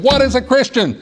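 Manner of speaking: preacher's climax. A narrator's reading. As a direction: build the question to the peak. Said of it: "he got a little louder and a little louder".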